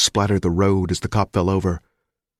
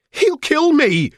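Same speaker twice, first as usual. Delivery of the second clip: much higher, louder, slow panicked cry; crying wildly; 5 dB louder, slower than usual. A narrator's reading. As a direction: scream it out in terror. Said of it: "she cried wildly".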